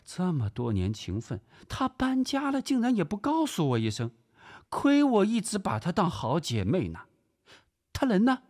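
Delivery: very high-pitched, gushing society wife; high dramatic housewife voice; theatrical lady visitor. Acted. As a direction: complain theatrically as the slighted best friend. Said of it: "朱峰的反应颇有点戏剧性".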